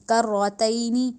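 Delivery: کرتینی drawn out slowly with a rounded heavy ر; slow tajweed demo; slow heavy recitation. Shown as a demonstration. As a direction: stretch کرتینی with rounded lips. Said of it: "موٹا، منہ کو گول کر کے".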